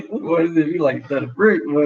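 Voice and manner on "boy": normal talking voice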